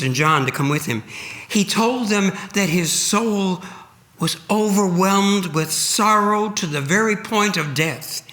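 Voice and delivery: usual speaking voice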